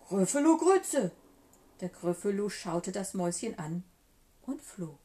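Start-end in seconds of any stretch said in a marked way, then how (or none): 0.1-1.1 high, loud shocked outcry; aghast yell of disbelief; horrified Gruffalo. A narrator's reading. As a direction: exclaim in monster horror. Monster horrified at being food.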